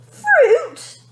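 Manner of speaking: high, loud furious cry; a spoilt girl yelling at her parents; furious shout. A narrator's reading. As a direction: explode at the word. Tantrum building.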